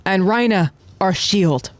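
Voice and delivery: high-pitched briefing, slowing at the end; dramatic battle report. Acted. Commander laying out the airborne assault, dramatic announcement. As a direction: proclaim the battle plan, drawing out the closing roles.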